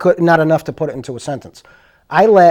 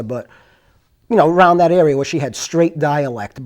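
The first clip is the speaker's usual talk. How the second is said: ordinary talk, nothing marked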